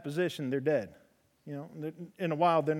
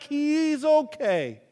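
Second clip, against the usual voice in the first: mock calming; 10 semitones higher, 6 dB louder, much slower than usual. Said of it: "I'm like".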